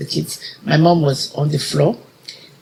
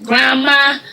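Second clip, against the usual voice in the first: calling out grandma; 9 semitones higher than usual. Retold or imitated. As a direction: call out for her, searching.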